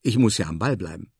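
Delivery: usual speaking voice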